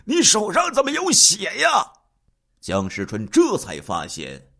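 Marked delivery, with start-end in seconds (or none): 0.1-1.7 high-pitched, alarmed yell; father crying out at his son; loud shout. A narrator's reading. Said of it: "江宗狗大声喊道".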